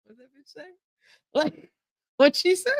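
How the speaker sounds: lightly voiced, high-pitched confused software; a high, puzzled questioning lift; baffled machine. Talk-to-text machine puzzling over her accent, baffled.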